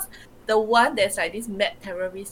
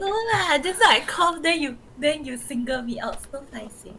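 embarrassed laugh; 7 semitones higher, 5 dB louder than usual. Real, not acted. Embarrassed after coughing.

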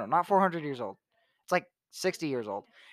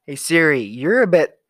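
loud call to Siri; 9 dB louder than usual. Acted.

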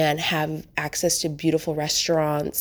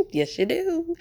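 higher, exaggerated Midwest accent; mock Midwest accent; 12 semitones higher than usual. Teasing Midwesterners who deny their accent, in mock Midwest accent. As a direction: tease with a hammed-up Midwest accent. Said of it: "not this accent that I just did".

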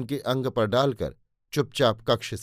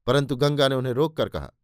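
ordinary delivery, nothing marked

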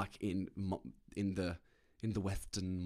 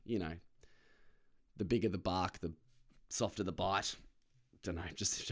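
usual speaking voice

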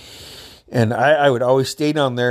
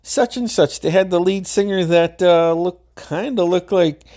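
imitating Dice Clay; 6 semitones higher than usual. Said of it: "he's like".

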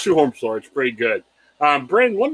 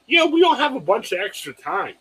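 normal talking voice

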